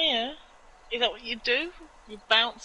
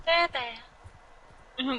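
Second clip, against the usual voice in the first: lady voice; 3 semitones higher than usual. Retold or imitated. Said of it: "With a lady voice".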